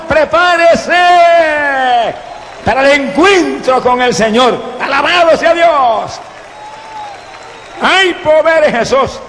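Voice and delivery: high, urgent revival preaching; fervent high-pitched proclamation; full-force preaching. Real, not acted. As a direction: cry out each phrase to the congregation.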